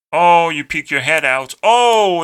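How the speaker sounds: loud, high exaggerated "oh"; mock surprise. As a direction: exclaim in hammed-up surprise. Mocks society's reaction to standing out.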